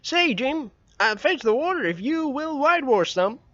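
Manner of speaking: put-on young Tom wheedling his friend; boy Tom. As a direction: coax him like a scheming boy. Narrator voices Tom.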